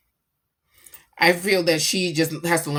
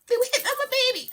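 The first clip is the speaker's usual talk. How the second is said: very high, rapid squeaky protest; mock alarm; much faster than usual. Parent retelling baby being hit on, high rapid mock alarm. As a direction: rush it out in a comic squeal.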